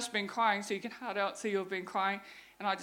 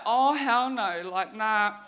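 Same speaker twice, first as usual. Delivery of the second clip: loud, drawn-out comic refusal; a slow, emphatic mock protest; retold refusal; 8 dB louder, much slower than usual. Ex-queen reacting to another title, comically refusing.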